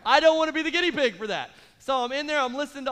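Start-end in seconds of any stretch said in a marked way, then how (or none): 0.1-1.5 higher, louder mock fright; scared refusal. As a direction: whine that you won't be the test case. Refusing to fly, exaggerated.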